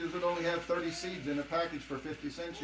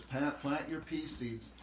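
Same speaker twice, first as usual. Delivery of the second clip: reading the package; 2 semitones lower, 5 dB quieter, slower than usual. Retold or imitated. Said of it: "The package says".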